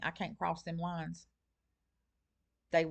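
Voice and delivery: ordinary talk, nothing marked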